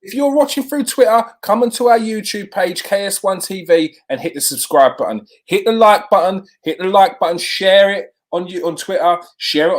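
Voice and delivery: rally the viewers to hit the button; hyped host pushing the subscribe button; excited plea. Real, not acted.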